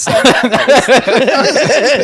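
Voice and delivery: high, slow, drawn-out question; amazed. Real, not acted. Host amazed at the jingle, asking who voiced it. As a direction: ask in delighted disbelief.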